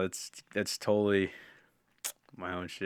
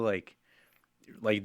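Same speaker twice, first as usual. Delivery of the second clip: usual speaking voice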